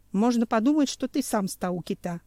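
plain reading voice

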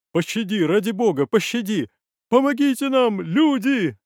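high, loud screams for mercy; desperate cries. Acted. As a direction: scream for help as if being killed. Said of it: "Крики двух уже знакомых мне голосом".

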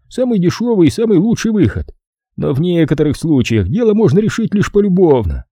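high-pitched boss voice; Fowler the boss, reasoning. Acted. (высокий голос босса, назидательно)

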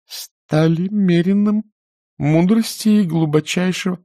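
slow, deliberate reading of меренным; slow high reading voice; careful reading. A narrator's reading. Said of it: "прочтёт его так, как оно должно звучать".

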